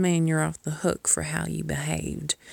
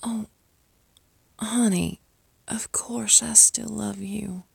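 tender whisper; 4 semitones higher than usual. Acted. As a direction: whisper it like a promise. Comforting after scolding.

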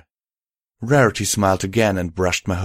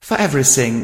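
higher, tearful gratitude; emotional, pitched-up voice; choked through tears; 6 semitones higher, 4 dB louder, faster than usual. A narrator's reading. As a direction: finish the thanks, overcome. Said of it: "choked out through the tears".

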